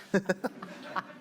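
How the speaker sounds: short unvoiced chuckle; real laugh. Real, not acted. Reader laughs at the verse.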